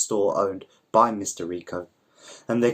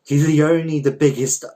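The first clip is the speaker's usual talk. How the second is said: exasperated insistence; 6 semitones higher, 7 dB louder than usual. Acted. Boyfriend defends Hendrix, exasperated.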